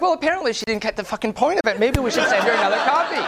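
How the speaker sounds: dry, mocking dig at her; sarcastic retort. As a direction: sneer it out sarcastically. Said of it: "I'm like".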